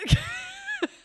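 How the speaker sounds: a shrill burst of laughter; real squeaky giggle; high squeal laugh. Real, not acted. Laughing at silly meme.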